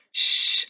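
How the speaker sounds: long hushing "shh"; shushing. Shown as a demonstration. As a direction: shush someone in a library. Acts out shushing a student.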